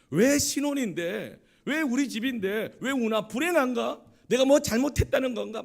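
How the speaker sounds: loud, high, indignant outburst; retold irritated anger. Newlywed husband angry at his crying wife, acted indignant shouting. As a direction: re-enact mounting exasperation, slow and forceful. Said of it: "더 짜증이 나고 더 화가 나는".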